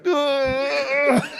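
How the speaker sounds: high burst of laughter; cracking up in class; laughing shriek. Retold or imitated. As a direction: lose it completely. Laughing fit again.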